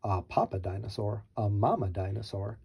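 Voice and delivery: ordinary delivery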